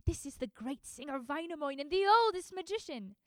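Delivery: put-on mother praising the old bridegroom; proud mother. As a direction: sell her the noble groom.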